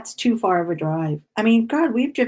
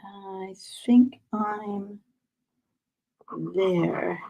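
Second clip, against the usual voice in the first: whisper; much slower than usual. Real, not acted. Showing viewers her cross stitch, slow whisper. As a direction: breathe it out, slow.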